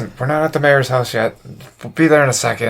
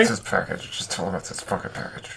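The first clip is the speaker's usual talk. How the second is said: barely voiced aside; very quiet, low whisper; hushed prompting; 5 semitones lower, 13 dB quieter than usual. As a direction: whisper the prompt to your partner. Player prompts teammate on the side.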